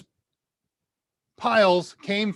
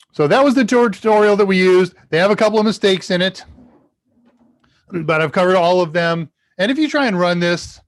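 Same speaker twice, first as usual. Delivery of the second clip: getting really loud; 3 semitones higher, 8 dB louder than usual. Real, not acted. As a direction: talk too loud into the mic. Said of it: "I'm getting really loud".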